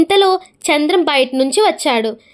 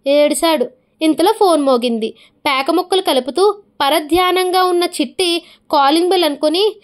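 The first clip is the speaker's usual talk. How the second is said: usual speaking voice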